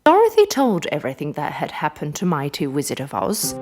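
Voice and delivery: plain reading voice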